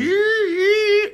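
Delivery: high squealing mock yelp; mock shriek. Husband imitating his wife's shriek while she vacuums. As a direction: squeal like a startled wife. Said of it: "It's like".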